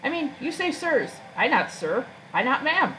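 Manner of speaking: high, swooping creature voice; creature with broken English. Acted. Creature objecting to being called sir, high voice.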